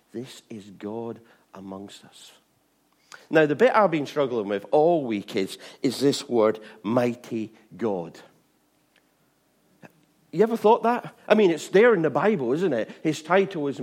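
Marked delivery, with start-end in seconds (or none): none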